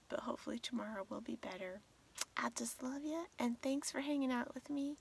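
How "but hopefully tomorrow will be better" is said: quick soft whisper; hurried sleepy whisper. Real, not acted. Sleepy late-night sign-off.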